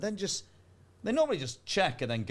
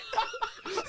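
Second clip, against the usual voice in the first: high burst of laughter; 3 dB quieter than usual. Real, not acted. Laughing at his own Fortnite confession, high squeal of laughter.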